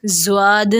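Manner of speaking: زواد with a buzzing z sound; za mistake. Shown as a demonstration. Teacher showing the za error. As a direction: say it wrongly as زواد. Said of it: "زا سے پڑھتے ہیں زواد".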